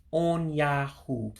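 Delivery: On Yahoo very slow, three clear syllables; slow model of On Yahoo. Shown as a demonstration. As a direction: spell out On Yahoo slowly for learners. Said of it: "So three syllables".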